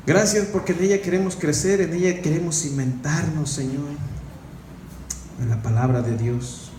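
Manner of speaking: soft, low prayer voice; closing prayer. Acted. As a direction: pray gently to the Lord.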